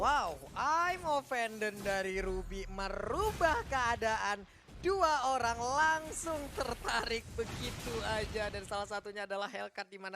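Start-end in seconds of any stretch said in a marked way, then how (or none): 0.0-8.5 excited shout. Acted. Caster shouts at big play.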